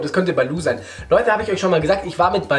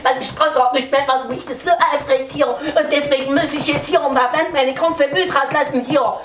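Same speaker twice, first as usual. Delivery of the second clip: higher, riled-up man; angry man voice; 8 semitones higher than usual. Retold or imitated. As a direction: act out a fuming guy. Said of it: "dann sagt er".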